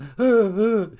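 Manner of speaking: mock sob gasp. Telling of ugly crying in cinema.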